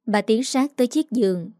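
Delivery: usual speaking voice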